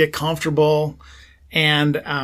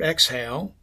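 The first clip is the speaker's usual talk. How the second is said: ordinary talk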